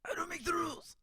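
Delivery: tiny, very high mock voice; [name]. Host echoes the mocking copy of herself, high and quiet. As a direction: squeak it softly, sending yourself up. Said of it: "[name] goes".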